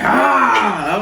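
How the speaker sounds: loud mock bear roar; bear growl. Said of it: "You was like".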